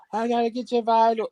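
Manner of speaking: mock nurse announcing a check; imitated nurse. Mimics nurse coming for vitals.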